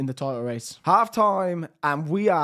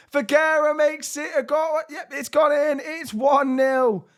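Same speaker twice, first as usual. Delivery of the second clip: much higher and louder excited shout; goal celebration; 10 semitones higher, 4 dB louder than usual. Real, not acted. Calling his team's goal in excitement, much higher and louder. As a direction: yell the goal like a commentator. Goal scored in own commentary.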